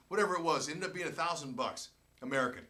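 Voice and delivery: normal talking voice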